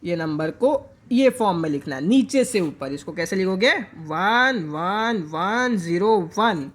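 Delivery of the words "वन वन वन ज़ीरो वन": each digit spaced out slowly; slow, deliberate dictation of a number; slow digit readout. Shown as a demonstration. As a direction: read the digits one by one for the class. Teacher reads out binary digits.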